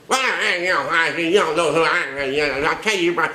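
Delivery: mock entitled caller. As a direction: bluster like a self-important caller.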